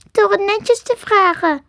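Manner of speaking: high, plaintive little voice; wailing squirrel, pitched high; whining squirrel. A narrator's reading. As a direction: whimper it like a small animal. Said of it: "jammerde het diertje".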